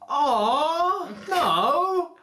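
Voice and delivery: high, loud mock wail of refusal; mock pleading. Mother begs the troll to spare her son, in a hammed-up wail.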